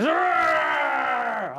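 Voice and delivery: long, high mock roar; dragon roar. Dragon at a flinching Artie, drawn-out roar. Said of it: "and the dragon goes".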